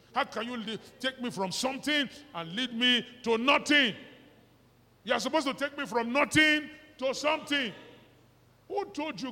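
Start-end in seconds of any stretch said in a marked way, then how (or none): none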